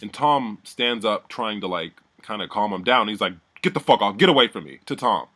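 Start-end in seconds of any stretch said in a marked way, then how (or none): 3.6-4.8 louder retold angry yell; imitated scream. Lowton pushing Tom away as he calms him, imitated scream. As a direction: imitate an angry brush-off, half-yelled. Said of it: "He, like, screams".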